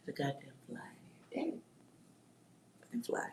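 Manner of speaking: cursing the fly under the breath; annoyed whisper. Real, not acted. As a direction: hiss it at the pest.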